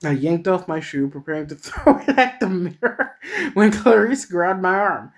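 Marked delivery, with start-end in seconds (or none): 1.1-5.1 breaking up mid-sentence; laughing while reading. Real, not acted. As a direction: voice climbing into a giggle. Reader cracks up while reading.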